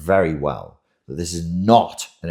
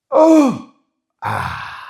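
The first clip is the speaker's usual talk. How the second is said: mock release; 9 dB louder than usual. Mock climax of explosive rage.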